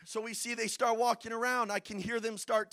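usual speaking voice

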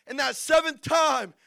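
loud, punched sermon build; forceful preacher's cry; preaching at full force. Real, not acted. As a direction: announce the turning point from the pulpit.

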